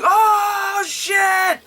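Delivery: high-pitched startled yell; shocked shout. Retold or imitated. Kids react to the game's answer.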